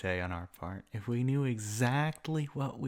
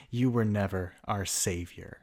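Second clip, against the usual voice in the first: ordinary talk, nothing marked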